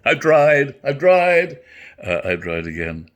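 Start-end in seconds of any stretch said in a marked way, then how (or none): 0.0-1.6 laughing. Real, not acted. Laughs at forgetting line.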